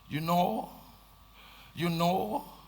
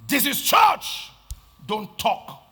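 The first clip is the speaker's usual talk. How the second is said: slow scolding shout; 3 semitones higher, 4 dB louder, slower than usual. Acted. Preacher shouting at quarreling church members, slow and loud. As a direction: shout it slowly, high and loud.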